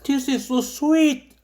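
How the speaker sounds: very slow, drawn-out savoring; dreamy slow English. Pretend. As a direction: linger over every word blissfully.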